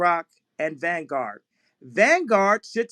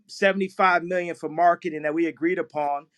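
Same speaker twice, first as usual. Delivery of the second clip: ordinary talk, nothing marked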